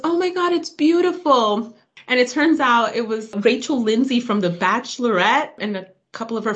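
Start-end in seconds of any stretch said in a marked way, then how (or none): 0.0-1.7 gushing onlooker. Retold or imitated. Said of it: "And she goes".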